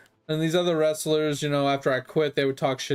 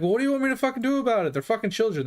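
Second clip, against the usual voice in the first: higher, quick retold protest; exasperated retort; 7 semitones higher, much faster than usual. Wrestler answering coach's demand to shut them up, exasperated. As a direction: throw it back in exasperation, pitched up.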